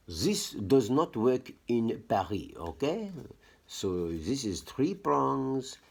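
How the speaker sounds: slow, high put-on French accent. Showing viewers the plug for Paris, in an exaggerated French accent. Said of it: "You hear, like, my French accent".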